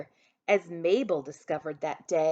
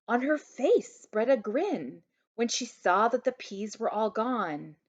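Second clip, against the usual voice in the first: ordinary delivery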